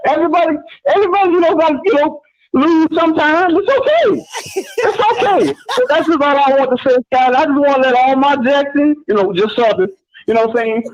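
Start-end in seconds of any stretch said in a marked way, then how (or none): 3.6-5.5 put-on high, drawn-out reassurance like calming a child; mock soothing. Caller teasing rival fans, cooing mock comfort. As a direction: coo it slowly to settle them down.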